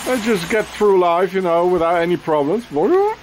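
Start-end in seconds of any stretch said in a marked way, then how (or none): 2.7-3.2 sudden high, sing-song "Bonjour"; playful high-pitched hello to a bird; high bird greeting. Real, not acted. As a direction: chirp it up high at the birds. Greeting birds passing by.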